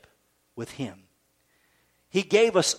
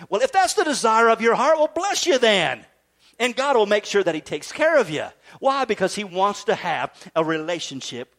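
raised, emphatic sermon voice; impassioned preaching; 5 semitones higher, 5 dB louder than usual. Acted. Preacher answering doubts about having six kids, impassioned. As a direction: proclaim it forcefully to the church.

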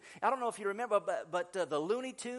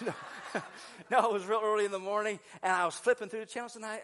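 normal talking voice

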